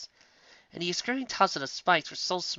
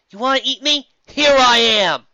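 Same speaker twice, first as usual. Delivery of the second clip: defiant shout; 10 semitones higher, 13 dB louder than usual. Acted. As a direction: dare the monster to come. Lyra taunts the Kraken.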